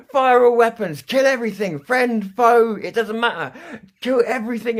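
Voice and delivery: high, loud mock captain screaming to kill everything; hammed-up bloodthirsty yell; manic shouted orders. Barking mad orders at AI.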